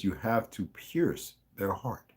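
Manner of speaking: "pierce" drawn out slowly; slow it down so "pierce" lands; slow emphatic line. Acted. Reader stressing lover's warning to listeners, slow emphasis. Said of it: "listen to this".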